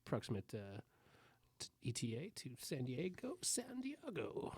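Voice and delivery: pilot voice. Acted. Pilot announcement parody.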